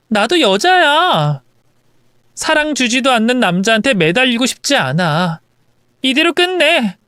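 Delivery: lightly acted resolute, hurt wife; wife demanding divorce. Wife telling husband she wants divorce now, resolute. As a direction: refuse his excuses and end it.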